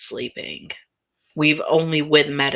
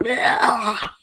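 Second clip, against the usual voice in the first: higher yucked-out "ah"; acted noise of wiping off the tongue; grossed-out groan, pitched up; disgusted "bleh"; 7 semitones higher than usual. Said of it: "starts, like, wiping her tongue off".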